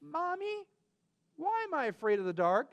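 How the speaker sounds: lightly voiced small boy, high on Mommy; little boy's question. A narrator's reading. Boy asks his mother why he fears dark, lightly childlike. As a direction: ask it like a curious little kid. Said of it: "Charlie said".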